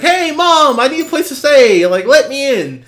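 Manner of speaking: high, loud calling through the door; pleading daughter. Retold or imitated. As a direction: shout up to mom to be let in.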